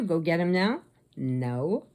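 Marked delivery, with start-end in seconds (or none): none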